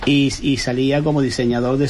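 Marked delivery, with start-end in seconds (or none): none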